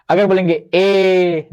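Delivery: slow held ए, tongue forward; drawn-out vowel ए. Shown as a demonstration. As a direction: stretch ए long. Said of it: "बस बोल के आप समझ जाएंगे".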